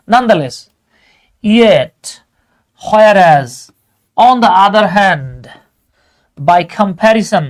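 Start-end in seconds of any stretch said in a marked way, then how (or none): none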